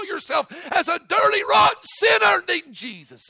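shouted high and loud, holding every phrase; fiery preaching. Acted. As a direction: thunder it at the church like a revival. Preacher climaxes at full force.

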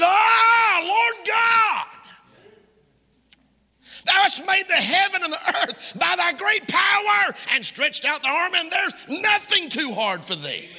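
high, full-voiced shout of praise. Preacher showing how Jeremiah cried to God, shouting. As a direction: shout the verse out with all your force. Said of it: "Shouted it".

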